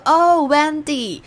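慢慢念名字. Retold or imitated. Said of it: "他就说".

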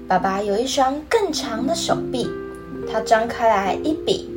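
put-on reading to a toddler. Storytelling narration with character voices.

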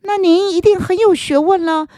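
high, admiring little frog. Acted. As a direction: ask eagerly like a small frog.

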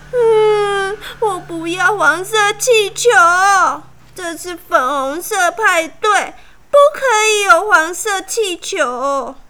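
high, loud sobbing protest; bawling. A narrator's reading. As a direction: sob it out like a tantrum. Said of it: "爱哭公主大哭了起来".

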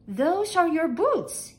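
high, slow, loud maid; high, projecting maid's voice; maid's answer. A narrator's reading.

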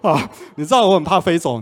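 ordinary delivery

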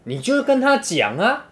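hammed-up girlfriend pushing him to confess; mock angry girlfriend. Girlfriend demanding her boyfriend confess to the friend, mock angry.

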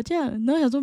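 ordinary talk

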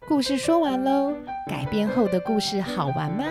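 normal talking voice

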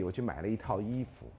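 ordinary talk

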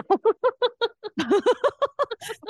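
loud high laughing fit; burst of laughter. Real, not acted. Laughing hard at the mother's blunt answer.

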